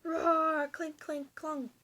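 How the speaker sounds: high roar, then clinking metal; high playful battle sounds; roar and clanking. Acted. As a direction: voice the fight noises.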